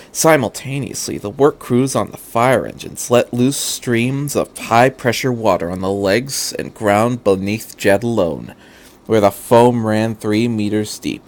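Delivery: ordinary delivery, nothing marked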